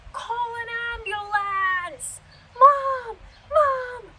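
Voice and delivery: high, loud, drawn-out wail for help; screaming child. Retold or imitated. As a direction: imitate a kid yelling at the top of his lungs. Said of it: "screaming at the top of his lungs".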